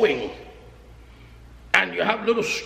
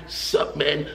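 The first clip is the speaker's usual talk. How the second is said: mock street greeting; 8 semitones lower, 5 dB quieter, much slower than usual. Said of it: "Everybody move their hand".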